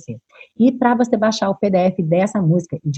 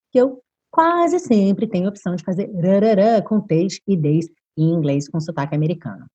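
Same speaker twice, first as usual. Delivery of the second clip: usual speaking voice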